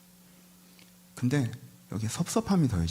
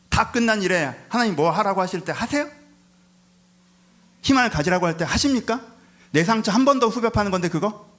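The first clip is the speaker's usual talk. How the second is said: loud, high indignant questions; impassioned challenge; 9 semitones higher, 7 dB louder than usual. Acted. Preacher asks the hurting to reopen the tomb, impassioned loud challenge. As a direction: push each question out as a raised protest.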